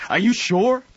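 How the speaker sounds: high, loud alarmed question; shocked. Acted. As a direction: blurt it in shock.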